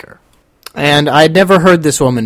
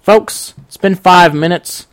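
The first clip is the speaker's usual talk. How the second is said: ordinary delivery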